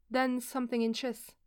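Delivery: usual speaking voice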